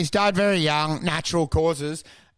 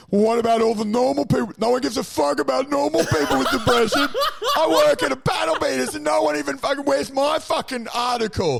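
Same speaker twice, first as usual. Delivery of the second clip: whiny aggrieved commenter; 8 semitones higher than usual. Retold or imitated. Mocking a jealous commenter under a depression article, high whiny rant. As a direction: rant like an offended nobody demanding attention. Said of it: "someone wrote underneath".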